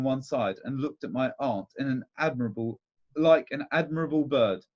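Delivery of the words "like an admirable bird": ordinary delivery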